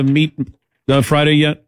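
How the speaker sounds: ordinary talk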